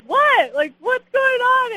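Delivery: high, loud, stunned "what's going on"; retold shock. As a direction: yell it out in disbelief. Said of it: "And I was like".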